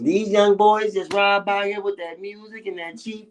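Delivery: high, drawn-out teasing impression; slow, high-pitched grumbling mockery; Mabel. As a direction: mimic an old-fashioned complainer about young folks. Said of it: "That's how you sound right now".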